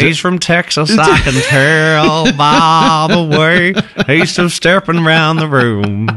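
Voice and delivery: slow, drawn-out Texan imitation; mock Texan accent. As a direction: tease with a hammed-up Texas drawl. Mimics country talk after explanation.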